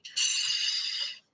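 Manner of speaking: urgent whispered shh; a tiny frightened shush; scared hush. Acted. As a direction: hush them fast, frightened. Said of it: "said the scared cub".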